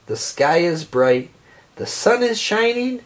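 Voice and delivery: ordinary delivery, nothing marked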